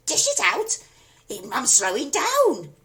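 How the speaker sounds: screechy crone yell. A narrator's reading. Grandma demanding more.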